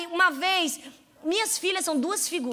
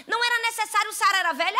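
ordinary talk, nothing marked

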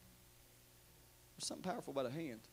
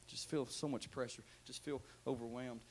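low, very soft weary voice; hushed, worn-out murmur; overwhelmed and weary; 5 semitones lower, 10 dB quieter than usual. Acted. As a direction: sag under the pressure, barely projecting. Voicing an overwhelmed, sleepless listener.

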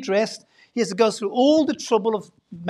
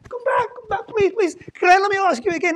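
high, fast, begging voice; pleading; 10 semitones higher, 6 dB louder than usual. Acted. God runs after Jonah, begging him to come back, high and fast. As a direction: plead like someone chasing after you.

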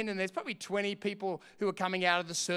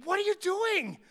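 retold yell; 12 semitones higher than usual. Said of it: "I went to him".

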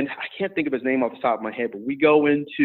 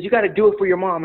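higher, urgent coaching plea; panicked coach; 7 semitones higher than usual. Retold or imitated. Coach pleading with his tired wrestler, mildly panicked. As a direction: urge him on like a coach in a light panic. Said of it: "all in a panic".

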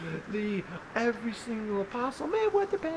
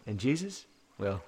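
hushed storytelling; 4 dB quieter than usual. A narrator's reading. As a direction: let it drift in, gently and quietly. Quiet wind-down narration.

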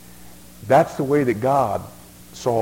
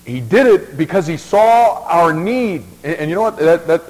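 louder, higher burst of preaching; preaching at full force; 5 semitones higher, 7 dB louder than usual. Acted. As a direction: lift the room with the good news.

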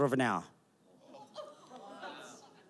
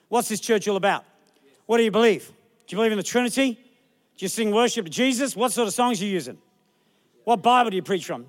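a hint of a nosy church inspector, slightly louder; mock interrogator; 3 semitones higher, 4 dB louder than usual. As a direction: grill the pastor like a busybody.

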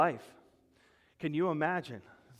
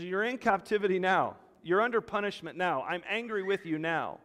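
louder, higher pronouncement; stern God voice; 6 semitones higher, 6 dB louder than usual. Acted. God telling captive Israel of punishment, stern. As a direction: announce the punishment firmly. Preacher voicing God speaking.

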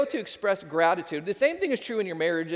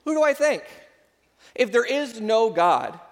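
preaching emphasis; 5 semitones higher, 6 dB louder than usual. Real, not acted. Preaching climax, rhetorical question.

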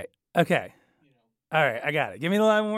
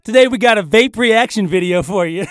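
loud, showy channel greeting; hammed-up internet host hello; vlogger intro; 4 semitones higher, 7 dB louder than usual. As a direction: punch it out like a streamer opening a video. Parody video host intro.

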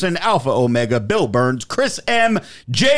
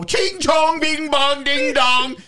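high sing-song mock promo chant; mock wrestling promo; 10 semitones higher, slower than usual. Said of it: "The Rock in a promo".

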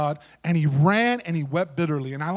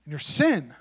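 usual speaking voice

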